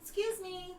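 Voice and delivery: high squeaky chipmunk voice; a light squeaky critter voice. Pretend. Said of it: "get on your chipmunk voice".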